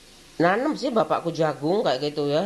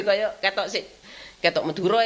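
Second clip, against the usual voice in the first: normal talking voice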